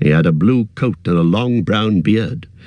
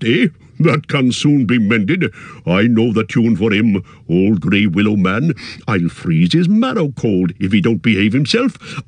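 put-on jolly old Tom, scornful of the willow. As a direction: brush off the danger like a trifle. Tom's voice, slow and scornful.

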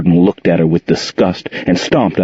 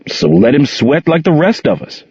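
retort; 7 semitones higher than usual. A narrator's reading. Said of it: "Gerald retorted".

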